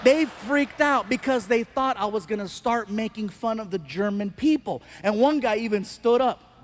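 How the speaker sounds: ordinary talk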